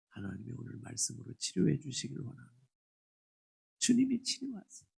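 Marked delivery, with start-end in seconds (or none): 3.8-4.9 ringing declaration, higher; proclaiming. Acted. Pastor proclaiming healing to the congregation, ringing. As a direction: announce the healing boldly. Repeated blessing, loud then whispered.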